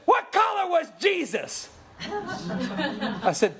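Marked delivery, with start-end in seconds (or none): 0.1-1.7 challenging shout. Retold or imitated. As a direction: fire the question like a challenge. Man challenging the preacher.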